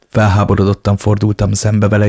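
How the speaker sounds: ordinary delivery, nothing marked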